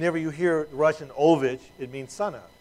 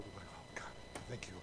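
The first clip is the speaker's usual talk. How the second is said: much softer, hushed low aside; mock whispered relief; 7 semitones lower, 21 dB quieter than usual. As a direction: breathe it out like a secret thank-you. Pastor's secret relief after sermon.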